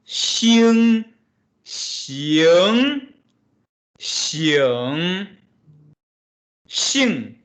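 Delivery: teacher demonstrating tones; four Mandarin tones. Shown as a demonstration. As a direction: run Xing through the four tones. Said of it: "Same principles".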